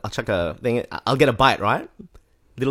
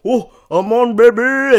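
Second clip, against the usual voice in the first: much higher and louder, whooping hype; wailing excitedly; 13 dB louder, slower than usual. Retold or imitated. Ninja mate hooking a fish, wailing high and loud. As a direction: crow it out over a fish on the line. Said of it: "He starts wailing".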